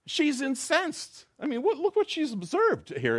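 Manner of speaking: preacher mimics her shock; indignant narration. A narrator's reading. Preacher telling the joke, indignant high voice.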